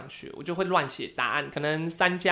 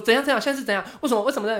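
alarmed mom; 6 semitones higher, 4 dB louder, much faster than usual. Retold or imitated. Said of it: "然后我妈就吓到".

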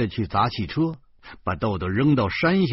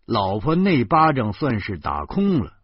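plain reading voice

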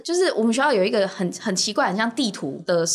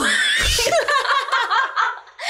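high rising burst of laughter; big laugh. Real, not acted. Teller laughing at her own ghost-story punchline. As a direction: crack up at your own joke.

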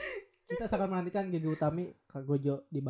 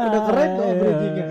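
loud laugh through the words; 5 semitones higher, 14 dB louder than usual. Real, not acted. Laughing at a fumbled closing. (sambil tertawa keras)

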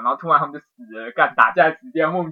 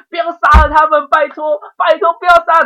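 higher and louder, a tearful begging wail; retold crying plea; 13 semitones higher, 11 dB louder than usual. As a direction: beg them not to kill, voice pitched up high in tears. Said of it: "一直哭说". (重演哭着哀求，音调高、声音大)

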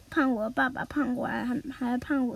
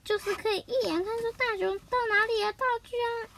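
a hint of a higher mom voice; mother's voice; 8 semitones higher than usual. Mother pressing Doraemon about the gadget, lightly voiced and higher.

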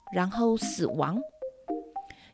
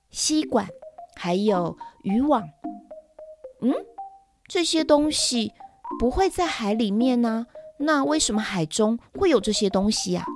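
ordinary talk, nothing marked

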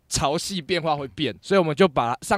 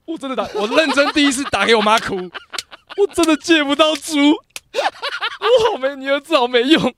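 much higher, slow half-laughing sob; mock crying; 12 semitones higher, 7 dB louder, slower than usual. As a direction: whimper to your mother, laughing at yourself.

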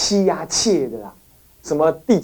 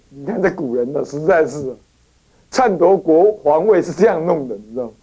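ordinary talk, nothing marked

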